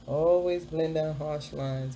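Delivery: "Always blend down harsh lines" said slowly, each word spaced; slow emphatic tip. Shown as a demonstration. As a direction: drag out the tip so it sinks in.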